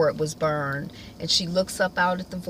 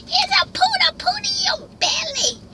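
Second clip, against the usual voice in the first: very high sing-song cooing. Real, not acted. Cooing at baby Bella.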